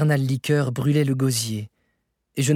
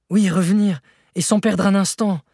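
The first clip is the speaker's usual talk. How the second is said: high, loud demand; urgent insistence; 7 semitones higher, 5 dB louder than usual. A narrator's reading. Nephew demanding an immediate return, urgently insistent. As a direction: insist forcefully, pitched up.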